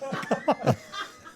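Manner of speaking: breathy honking duck call; duck call honks. Shown as a demonstration. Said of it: "that slow, seductive call".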